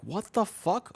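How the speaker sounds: imitating a weirded-out stranger; baffled stranger. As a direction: toss it back in disbelief.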